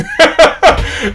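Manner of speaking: a quick high burst of laughter at himself; amused self-mocking chuckle; short real laugh. Real, not acted. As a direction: laugh off the rambling. Laughs at his own long rambling.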